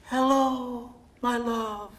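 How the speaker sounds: put-on a faint lure from the girl in yellow; ghost girl's greeting. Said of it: "her lips mouthing".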